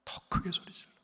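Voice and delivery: 더 크게 소리 질러 breathed in a hushed whisper. Shown as a demonstration. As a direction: drop 더 크게 소리 질러 to a whisper. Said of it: "주눅 들지 않았어요".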